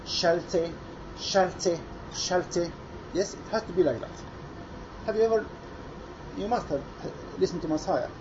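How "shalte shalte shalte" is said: "Shalte" with the sh and t hit hard and clear; "Shalte", strong sh, sharp t; crisp sh and t. Shown as a demonstration. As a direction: spit out the sh and t in "Shalte". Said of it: "pronounce the sh and the t in a very strong way".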